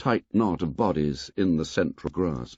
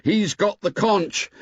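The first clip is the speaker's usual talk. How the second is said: shouted; 8 semitones higher, 3 dB louder than usual. A narrator's reading. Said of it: "Ralph shouted".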